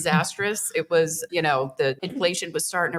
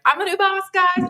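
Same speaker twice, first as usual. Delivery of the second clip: much higher and louder, bouncy i'm a new boss; mock cheery announcement; 4 dB louder than usual. New owner imagining telling staff, mock cheery. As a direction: ham up a proud reveal.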